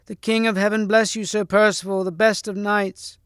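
high-pitched ringing proclamation; crying aloud. Acted. Damsel hails Sir Percival before the hall, crying aloud. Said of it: "cried aloud".

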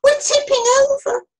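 high, loud shout of alarm; panicked cry. A narrator's reading. Another sailor on a tipping boat, panicked. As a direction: cry out as if about to capsize. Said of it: "cried another sailor".

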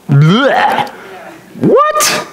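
mock gag, then a very high startled yelp; shocked disgust. Preacher hearing his own curse word, hammed-up shocked disgust. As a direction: recoil from your own word in comic disbelief.

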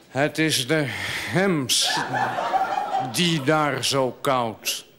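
solemn verse delivery; poetry recitation. Acted. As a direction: recite it line by line with pauses. Recites the chamois poem.

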